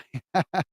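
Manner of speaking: short amused chuckle; light quick laugh. Real, not acted. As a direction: chuckle briefly to yourself. Amused at own try-it attitude.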